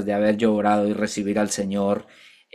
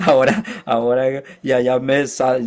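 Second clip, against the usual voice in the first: ordinary talk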